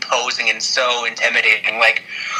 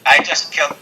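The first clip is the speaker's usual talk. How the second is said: normal talking voice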